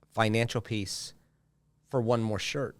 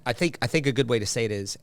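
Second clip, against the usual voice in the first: normal talking voice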